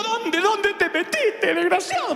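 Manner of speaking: mother finding her lost son in the mall, scolding shrilly; frantic mother. Retold or imitated. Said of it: "Me dijo".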